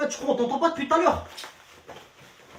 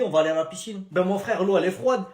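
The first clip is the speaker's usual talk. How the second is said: ordinary talk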